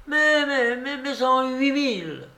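put-on very high-pitched insistent old lady; impatient babouchka. Babouchka impatiently orders bigger bet. (insistance impatiente, voix très aiguë)

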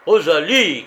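loud, high angry call; irritated shout. A narrator's reading. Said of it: "de plus en plus irrité".

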